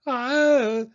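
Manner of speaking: high, held scream of fright. A narrator's reading. Franklin meets a Tyrannosaurus round the corner, screaming. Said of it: "screamed Franklin".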